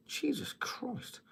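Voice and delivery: slow, high and breathy disbelief; shocked at the price. Real, not acted. Reading a comment on beer price, slow stunned disbelief. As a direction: let the price stun you.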